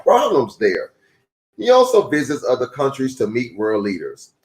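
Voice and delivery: steady, clear read-aloud voice; reading to children. A narrator's reading. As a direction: read it like a picture book for kids. Reads a children's book aloud.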